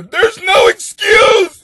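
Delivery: slow, high furious shout; angry yell. Real, not acted. Comic scolds the cheating letter writer, slow angry shout. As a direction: hammer the point home, yelling.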